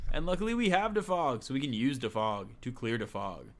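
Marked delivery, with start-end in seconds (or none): none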